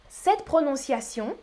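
usual speaking voice

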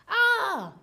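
sudden high "¡Ah!" of discovery; mock surprise. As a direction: pounce on them with a yelp. Seeker finds hiding players.

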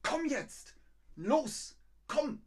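high, loud sharp orders; dog command. Acted. Owner ordering a dog to come, sharp high commands. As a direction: call a dog to heel, insistently. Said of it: "Der Hund zum Beispiel".

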